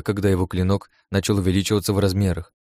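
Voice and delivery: ordinary delivery, nothing marked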